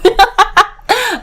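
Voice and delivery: bright burst of laughter at her own joke; a big loud laugh after a joke. Real, not acted. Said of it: "I'm joking though".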